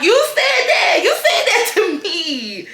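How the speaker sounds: high, loud incredulous yell with a laugh; laughing shout. Retold or imitated. Yelling at the man in disbelief, laughing. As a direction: throw it back at him, laughing.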